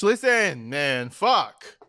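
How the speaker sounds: ordinary talk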